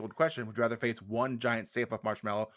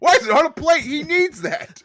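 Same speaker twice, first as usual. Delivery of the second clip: high, loud, stretched-out protest; outraged squeal; 12 dB louder than usual. Acted. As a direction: protest like it's a scandal. Mock outrage at liver dish.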